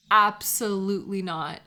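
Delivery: ordinary delivery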